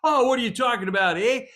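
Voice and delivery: breezy Canadian agent, fast and cheerful; hammed-up Canadian brush-off; Canadian accent. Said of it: "they really do talk that way".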